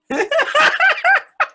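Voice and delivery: high giggling burst; high burst of laughter. Real, not acted. Comic at the mic laughing hard at her own joke.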